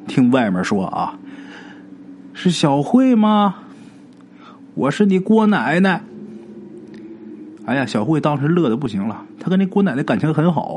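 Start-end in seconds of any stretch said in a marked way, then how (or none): 2.3-6.0 high, slow, drawn-out call of a dead grandma at the door; ghostly granny call. Acted. Dead grandma calls the girl from outside, high and slow. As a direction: coax the child sweetly, slow and high.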